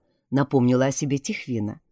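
ordinary delivery, nothing marked

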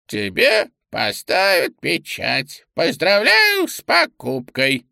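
slow, high creaky clerk. Acted. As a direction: creak it out slowly like an old clerk. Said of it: "проскрипел скрипучим голосом".

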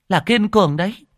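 high and loud, rising on Kiên; excited insisting. A narrator's reading. Old woman drills the name into the madwoman, high and loud. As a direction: drum the name Kiên Cường into her.

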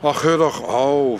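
loud, dismissive burst; exasperated outburst. Acted. Father fed up with daughter's insinuations, loud exasperation. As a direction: wave her off loudly. Daughter accuses guest of gold-digging.

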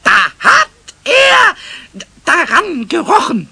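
hoch und laut, langsam; enthüllt die Untat mit Wucht; empörte Enthüllung. Acted. Pumuckl enthüllt empört Stefans Tat. (Pumuckl petzt dem Meister, hoch und langsam)

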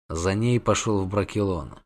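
ordinary delivery

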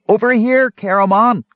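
muffled high call through a hand; hand over mouth. A narrator's reading. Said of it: "strangely muffled, as if he were talking into his hand".

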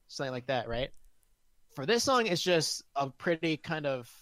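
ordinary talk

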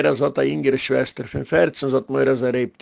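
usual speaking voice

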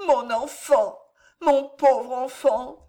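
cri aigu et lent de mère désespérée; désespoir. A narrator's reading. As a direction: laisser éclater la douleur, lentement. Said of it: "condensa tout son désespoir". (une mère désespérée pleure son enfant à naître)

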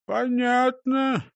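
slow, high, stretched-out mumble; muttering. Acted. Disguised driver answers policeman, slow uneasy mutter. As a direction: drag the word out from behind a false beard. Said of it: "пробормотал Крендель из-под фальшивой бороды".

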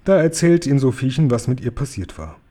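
usual speaking voice

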